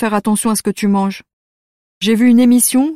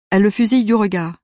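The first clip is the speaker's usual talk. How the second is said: plain reading voice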